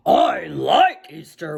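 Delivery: slow, rough wolf voice. Acted. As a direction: growl it like a friendly wolf. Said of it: "wolf voice".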